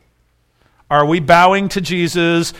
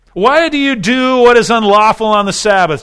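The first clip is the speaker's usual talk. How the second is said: higher, louder indignant demand; mock outraged challenge; accusing Pharisees; 7 semitones higher, 6 dB louder than usual. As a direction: throw the accusation at him.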